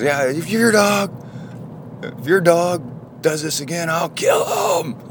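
slow, very high shouted threat; threatening. Retold or imitated. Big neighbor threatening to kill the dog, shouting. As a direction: shout it like a big man about to snap. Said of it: "Like that. Really, like, that was really like that. Very threatening".

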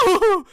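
high, loud whooping scream; comic falling scream; Wilhelm scream. Acted. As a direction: yelp like a stuntman flung through the air. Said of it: "A Wilhelm scream as this goblin".

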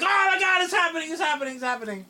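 hammed-up fanboy losing it; screaming with fake joy over casting news; mock ecstatic shout. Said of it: "I'm like".

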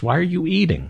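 ordinary delivery

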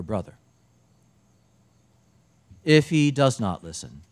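solemn liturgical reading voice; gospel reading. Acted. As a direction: proclaim the gospel in church.

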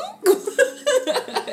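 high, loud burst of laughter. Real, not acted. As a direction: cackle at the absurdity. Laugh alone. (carcajada aguda)

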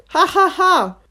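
high, loud fake ha-ha-ha; deliberately bad impression of laughter; mock laughter. Shown as a demonstration. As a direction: laugh artificially, each ha spoken out. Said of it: "my impression of laughter".

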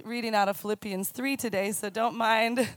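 ordinary delivery

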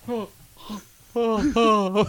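high, puckered little gasps; mock gulping for air; gasping guppy imitation. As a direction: mimic a fish out of water. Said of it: "look like a guppy out of fucking water".

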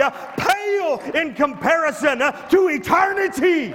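rising preacher cadence, building to shouts; full-force preaching. Acted. Pastor to congregation, climbing from firm preaching to shouted. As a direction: preach it harder and harder toward eternity.

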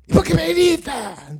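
very loud, high indignant yell; shouting back. Acted. Woken sleeper to those shouting at him, shouting back. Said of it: "¿O empieza a gritar usted también?".

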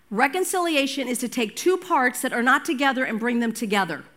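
ordinary delivery

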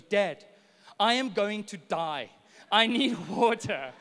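put-on loud, slowed, desperate child's cry for water; son's dramatic plea. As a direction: play the overdramatic little boy who thinks he'll die. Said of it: "he goes like this".

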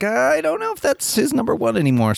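high-pitched, hedging doubt; doubtful thought. Retold or imitated. Co-host quoting own doubt about the top game, high and unsure. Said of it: "I was like".

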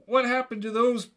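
high-pitched cry of horror; hammed-up horrified question; horrified yell. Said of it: "I yelled in, in horror".

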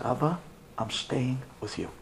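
low, soft, firm promise; tender resolve. Retold or imitated. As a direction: quietly refuse to leave your father. Quiet resolve to father.

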